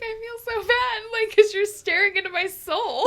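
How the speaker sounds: hammed-up high-pitched wail of guilt; mock distress. Storyteller to the one staring, mock-distressed protest. Acts out own guilty reaction.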